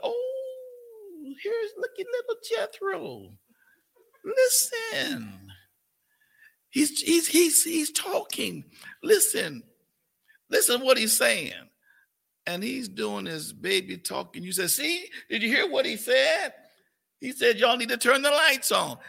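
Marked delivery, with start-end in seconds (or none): 0.0-11.5 put-on very high-pitched fussing over a baby; excited cooing grandparent. Grandparent telling the family to listen to the baby, excited cooing. 14.7-19.0 put-on raised, loud voice insisting the baby spoke; excited proud grandparent. Grandparent translating baby talk for the family, excited insistence.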